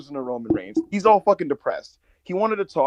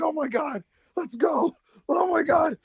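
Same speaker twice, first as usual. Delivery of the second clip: fan excitement; 11 semitones higher than usual. Retold or imitated. Fan reacting to Jacob's dance.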